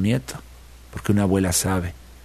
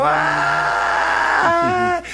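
long high falling yell; falling scream. A narrator's reading. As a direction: scream as he drops from the sky. Coyote falling from sky. (grito largo y agudo de caída)

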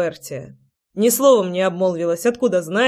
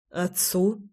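soft questioning rise; surprised; 6 dB quieter than usual. A narrator's reading. Man learns friends phoned his father, mildly surprised. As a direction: echo it softly in mild surprise. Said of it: "удивился Райлих".

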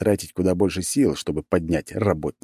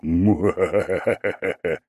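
evil villain laugh; mustache twirling villain laugh. Acted. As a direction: cackle like a gloating villain. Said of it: "И тут должен быть злодейский смех".

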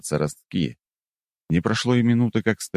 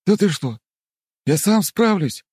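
high, louder startled protest; 4 dB louder than usual. Acted. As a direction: perk up and protest. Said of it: "встрепенулся Картополов".

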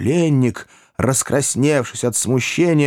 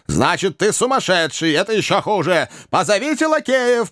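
much higher, alarmed scolding; angry old gentleman; 10 semitones higher than usual. A narrator's reading. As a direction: shout for the footmen. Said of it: "повторил старый джентльмен". (сердитый старик, намного выше)

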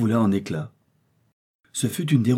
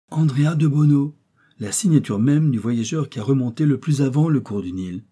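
louder and higher, elated; excited; 5 semitones higher, 5 dB louder than usual. Acted. Doctor identifying the explorer's signature to Kennedy, elated. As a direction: proclaim the name like proof found.

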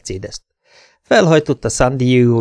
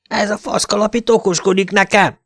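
higher, angry shout at a smart-mouth; yelling cop; 10 semitones higher than usual. Acted. Short cop yelling at the mouthy detective, angry shout. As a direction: bark it out, outraged. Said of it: "üvöltötte az alacsonyabbik rendőr".

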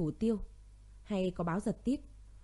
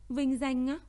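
"vinh danh á" said higher with a sharp incredulous rise on "á"; bitter disbelief; 5 semitones higher, 3 dB louder, slower than usual. Acted. Grieving woman mocking the promised honours, incredulous rise. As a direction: throw "vinh danh á" back as a scornful question. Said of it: "Cô bật cười chua xót".

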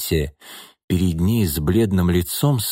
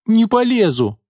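put-on high-pitched little girl's refusal; defiant child; 13 semitones higher than usual. A narrator's reading. Daughter resisting being put in the car, defiant. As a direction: refuse point-blank like an upset child.

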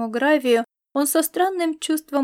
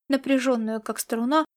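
plain reading voice

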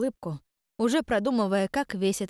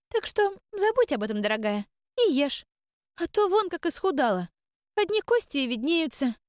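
fussing grandmother spirit; 9 semitones higher than usual. Acted. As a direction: fuss over her like a doting granny. Old spirit fussing over girl.

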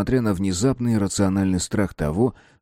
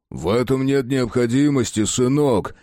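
plain reading voice

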